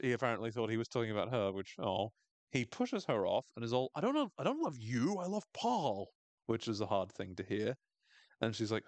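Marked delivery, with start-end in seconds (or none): 4.0-6.1 high mock-desperate protest; exasperated confession. Coach pushing Misty off, exasperated. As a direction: blurt it out, pitched up. Acted coach rejecting the girl.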